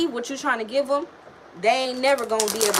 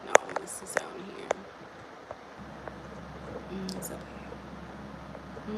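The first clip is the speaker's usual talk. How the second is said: whispered to herself; soft unvoiced aside; 3 semitones lower, 12 dB quieter than usual. Real, not acted. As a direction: breathe it under your breath.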